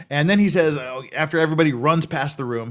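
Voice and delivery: ordinary delivery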